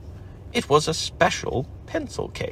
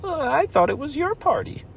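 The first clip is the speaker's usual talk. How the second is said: much higher timid little voice; small Piglet voice. Acted. Piglet whispering to Pooh in a tiny voice. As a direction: make it tiny and high.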